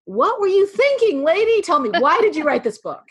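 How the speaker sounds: high, playful grilling of a friend; teasing, exaggerated bafflement; mock incredulity. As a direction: demand an answer in playful disbelief, pitch swooping up.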